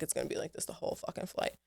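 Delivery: whispered to herself, fast and under her breath; muttered aside. Retold or imitated. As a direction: grumble it low so the couple won't hear. Private grumble on the plane.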